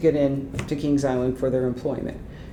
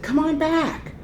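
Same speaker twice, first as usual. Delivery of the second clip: high, sing-out invitation; retold high-pitched beckoning call; Gwen calling over; 12 semitones higher than usual. Colleague calls mother over.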